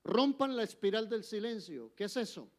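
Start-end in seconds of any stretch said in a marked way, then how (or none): none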